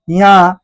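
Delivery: यहाँ said as one long nasal syllable; nasalised sound. Shown as a demonstration. Teacher demonstrating nose sound, drawn-out nasal यहाँ. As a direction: let यहाँ ring through the nose. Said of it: "नाक से, है ना".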